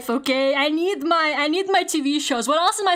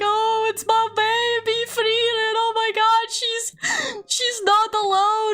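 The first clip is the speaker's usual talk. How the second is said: high, gushing over a beloved character; half-laughing, half-teary squeal of relief; mock sob of pride over her baby; gushing tearful joy; 8 semitones higher than usual. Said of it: "I start crying at the opening because I'm like".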